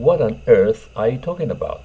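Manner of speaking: slow, careful English demo; English model phrase. Shown as a demonstration. Teacher models the English phrase slowly for students. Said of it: "これを英語で言います".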